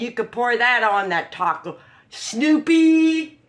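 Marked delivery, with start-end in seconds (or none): 2.1-3.4 louder call to Snoopy, pitch up. Real, not acted. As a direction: call across the room to Snoopy. Calling out to Snoopy.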